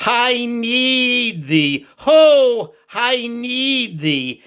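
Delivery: high, slow, pleading prayer voice; drawn-out, high prayer as an elderly churchman; heartfelt prayer. Retold or imitated. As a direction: lift it up slowly as a fervent old man's prayer. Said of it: "with all of his heart, he said this as a prayer".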